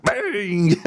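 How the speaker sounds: hyped host intro, stretched high at the end; show intro. Acted. Host greeting the gamers to a new episode, hyped intro. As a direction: build into a drawn-out, high-pitched show title. Performs the show's opening greeting.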